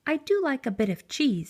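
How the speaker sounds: sweetly hint at cheese; little mouse voice. A narrator's reading.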